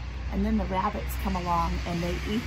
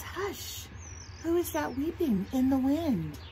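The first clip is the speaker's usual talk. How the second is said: higher, lilting storybook voice; lullaby reading; 4 semitones higher, slower than usual. Acted. Teacher reading a Thai lullaby to children, lilting. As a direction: read it as a gentle lilting verse. Reads lullaby verse aloud.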